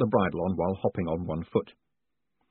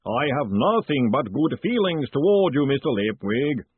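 loud, slow cheerful giant; cheerful booming voice; 5 semitones higher, 4 dB louder than usual. Acted. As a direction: boom it out cheerfully. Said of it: "boomed the voice cheerfully".

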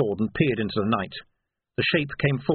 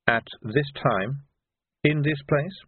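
slow, measured echo, rising at the end; controlled patrician query; pointed questioning; 4 semitones higher, slower than usual. Acted. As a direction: ask it deliberately, phrase by phrase. Vetinari quietly echoes the outburst.

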